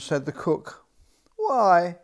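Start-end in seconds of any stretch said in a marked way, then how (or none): 1.4-2.0 put-on loud, higher child's why; a cheeky child asking back; little princess voice. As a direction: princess pushing back at the cook. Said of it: "said the little princess".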